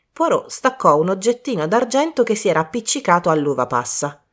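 plain reading voice

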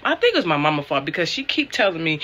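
ordinary talk, nothing marked

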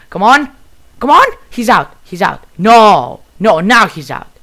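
loud, high cheering-on; urgent excitement. Real, not acted. Player urging the final kill in the match, excited.

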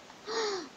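high, quick gasp of shock; sharp gasp. Real, not acted. Gamer caught talking on tape, sharp gasp.

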